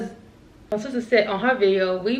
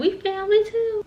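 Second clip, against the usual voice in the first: high, mimicking whine; mock protest; 12 semitones higher than usual. Girl in line claims kinship, mocked in high pitch. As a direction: imitate the left-out girl, way up high.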